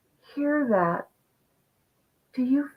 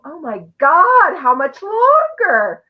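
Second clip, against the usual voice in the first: loud, high, fed-up outburst; drawn-out exasperated how much longer; exasperated cry; 10 semitones higher, 10 dB louder, slower than usual. Retold or imitated. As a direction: wail it like you can't take another day. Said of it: "I got up and I go".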